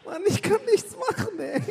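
high, slow helpless whine; helpless squeaky voice. Acted. Angel voice continues.

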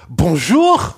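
high, loud, indignant Bonjour!; mock offended shopkeeper calling Bonjour!; curt Parisian bonjour. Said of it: "And they go".

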